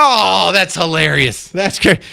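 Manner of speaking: high, gushing fan delight; thrilled reaction. Retold or imitated. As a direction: gush upward, hammed-up excitement. Acts own delighted reply to star.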